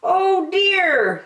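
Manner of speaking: high, kindly park keeper; a gentle, fussy park keeper; Percy's voice. A narrator's reading. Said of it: "said Percy".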